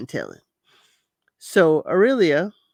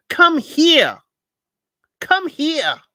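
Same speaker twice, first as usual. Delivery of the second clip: mock scolding mother; 8 semitones higher, 6 dB louder, much slower than usual. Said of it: "talking to Evandro like he's her child".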